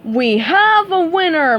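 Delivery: host crowning the winner; host's cry. Acted. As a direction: announce the win with flair. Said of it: "Mo Blowfish cried".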